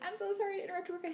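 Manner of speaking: high, rushed starstruck apology; flustered fangirl, high and hurried; nervous apology. Acted. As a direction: squeak it out fast, acting flustered. Acts out nervous apology to celebrity.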